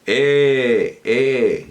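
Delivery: "E" stretched out slowly as a sound sample; drawn-out vowel E. Shown as a demonstration. Teacher showing that lips can part, demonstrating a long E. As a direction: hold a long, steady "E". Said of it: "Y si digo".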